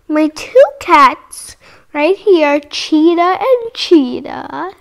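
ordinary delivery

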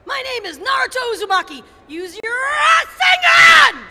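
high, loud, shouting anime ninja; Naruto voice. Shown as a demonstration. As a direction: yell it out as Naruto. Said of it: "hear you do a really quick Naruto reaction".

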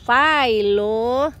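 loud drawn-out call across the house; calling out to the cat. Real, not acted. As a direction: owner calls her cat Philo, loud and long.

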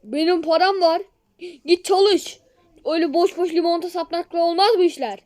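loud, high scolding; angry shouting. Pretend. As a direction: yell at the beggar. Rich man scolds beggar.

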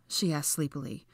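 ordinary delivery

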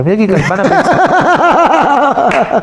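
high, slow words broken by laughter; laughing. Real, not acted. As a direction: laugh through the line as it comes out. Laughing mid-story about stomach trouble.

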